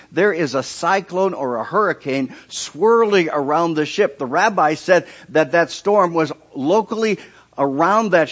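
loud, high preacher's build; fiery sermon delivery; full-force preaching. Acted. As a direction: preach it out to the crowd.

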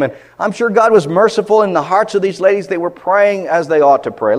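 loud, high pulpit delivery; full-force preaching. Acted. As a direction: drive the point home fervently.

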